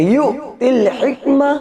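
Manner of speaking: "यू" stopped with a jerk before "तिल हिकमा"; jerked hamza demonstration. Shown as a demonstration. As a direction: chant "यू तिल हिकमा", catching the hamza after "यू". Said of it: "इसको इस तरीके से बोलेंगे".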